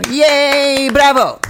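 high, loud cheer for the guest. Real, not acted. Host welcomes her first guest, cheering. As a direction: applaud with your voice, high and excited.

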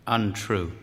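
low, soft, dropped chest voice; husband's one word. Acted. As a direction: drop into his chest and say it quietly. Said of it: "When he uses a chest voice to say this word, it catches in his throat".

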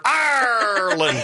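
"Ireland" with a hugely stretched first syllable; mock mispronunciation. Host mocking panelist at airline counter, Ireland absurdly stretched. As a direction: drawl "Ireland" as one long "arrr" then land. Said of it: "No one walks up to United Airlines and says".

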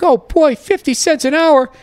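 put-on a squeaky, overjoyed dumb kid counting the money; naive kid. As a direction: play his dumb nineteen-year-old self thrilled at fifty cents. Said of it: "And I was like".

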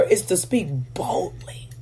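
a sudden hushed whisper. Real, not acted. Preacher whispering the point to his hearers. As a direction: drop to a breathy whisper to drive it home.

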